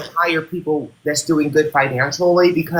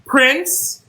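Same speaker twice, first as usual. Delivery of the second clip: high, rising summons; calling out; 9 semitones higher than usual. Real, not acted. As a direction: call the name up high like fetching someone. Calling to a viewer by name.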